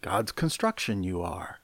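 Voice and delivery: quiet, lightly voiced sage aside; soft, low hint of an impression; Yoda. Preacher joking about backward word order, hushed hint of Yoda. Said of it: "Sounds like Yoda, doesn't it?".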